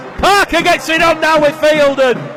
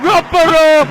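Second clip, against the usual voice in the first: emotional call; 2 semitones higher than usual. Real, not acted. Rob Burrow comes on field.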